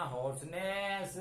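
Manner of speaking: "Hoarseness" drawn out slowly, every sound clear; slow model word for the class; slow teaching word. Shown as a demonstration. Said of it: "horse जो है घोड़ा वाला horse नहीं है".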